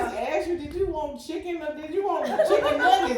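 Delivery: high, loud, laughing mock argument; mock petty fight. A wife mocking her own silly argument, high and laughing. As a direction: lampoon the silly fight over chicken nuggets. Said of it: "It's like".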